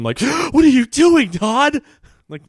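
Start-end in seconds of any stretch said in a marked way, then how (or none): 0.2-1.8 high-pitched, loud, hammed-up disbelief after a gasp; mock shock. Sister walks in on her brother smoking crack, mock shocked. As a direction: imitate a scandalized sister, voice leaping up. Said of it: "seems shocked every time she walks in".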